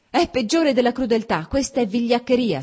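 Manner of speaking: loud, furious outburst; shouting in sudden anger; burst of rage. A narrator's reading. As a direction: hurl the accusation at him. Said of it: "gridò Anna in uno scoppio di rabbia".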